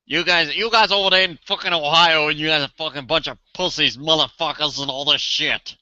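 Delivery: put-on lampoon the guy's accent with a sneer; mocking a cocky Ohio biker's accent. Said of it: "the accent that he has".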